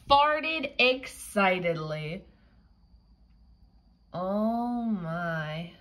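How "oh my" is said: ordinary delivery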